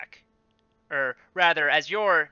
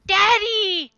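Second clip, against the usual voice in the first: put-on high-pitched child's shout for daddy; child calling out; 11 dB louder than usual. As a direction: shriek it like a frightened kid. Kids cry out to fallen dad.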